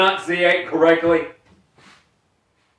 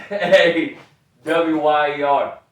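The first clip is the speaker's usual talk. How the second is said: ordinary talk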